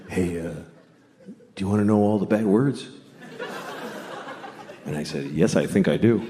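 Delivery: put-on low, hushed old-man voice; low quiet old man. Old man teaching a kid bad words, low and hushed.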